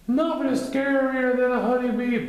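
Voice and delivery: high, loud certain bear; decided Pooh. A narrator's reading. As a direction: declare it firmly. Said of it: "Pooh decided".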